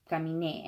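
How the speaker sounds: "caminé" with an obvious stress on é; punched last syllable. Shown as a demonstration. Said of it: "you gotta punch that last syllable".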